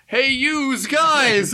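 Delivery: put-on drawn-out, high-pitched caricature American accent; cartoonish American street-tough accent, very slow and high; hammed-up American accent. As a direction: stretch it out in a broad American wiseguy accent. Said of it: "you really have this, like".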